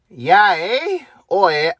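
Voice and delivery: put-on Canadian lilt, lightly hammed; Canadian accent. Second try at full Canadian mode to end the rant, mock Canadian accent. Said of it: "me going full Canadian mode".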